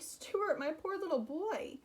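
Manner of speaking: high, anxious mother's cry; worried mother. Acted. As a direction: fuss over her little boy. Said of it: "she cried".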